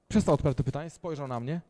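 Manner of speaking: ordinary delivery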